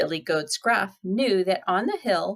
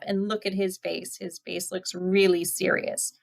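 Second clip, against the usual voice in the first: usual speaking voice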